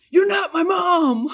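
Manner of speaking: high, loud yelled retort; mock shout. Husband screaming at wife, high loud shout. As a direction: bark it back like a defiant husband. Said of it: "why are you screaming at me".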